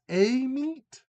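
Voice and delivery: "a meat" as AY-meet, slow and questioning; long A. Shown as a demonstration. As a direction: stretch the "a" of "a meat" into a high, loud AY. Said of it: "Like, just long A?".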